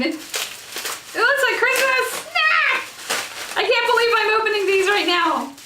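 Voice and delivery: can't believe she's finally opening the palettes; overjoyed squeal. Real, not acted. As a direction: burst out in real thrilled excitement.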